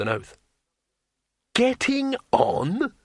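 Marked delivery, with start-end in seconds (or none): 1.5-2.9 slow, very high incredulous question; astonished. Acted. Wizard questioning the naive tourist, astonished. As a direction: stretch it out in disbelief. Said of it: "Rincewind stopped in astonishment".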